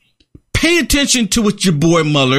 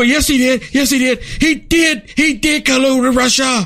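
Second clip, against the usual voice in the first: higher, mocking insistent chant; mocking the left; 6 semitones higher than usual. Mocking leftists who deny Mueller, insistent higher chant. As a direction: parrot their stubborn yes he did. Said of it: "And they go".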